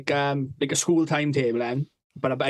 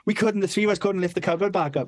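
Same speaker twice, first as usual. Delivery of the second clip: ordinary talk, nothing marked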